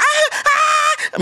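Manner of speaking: very high shrill mock screams; mock panicked screaming. Bystander screaming at a fire, mock panicked screaming. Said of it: "sumisigaw siya".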